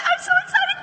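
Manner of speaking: very high squealed excitement; bursting with gossip. Shown as a demonstration. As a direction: gush it like hot gossip. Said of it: "sound like you've got gossip".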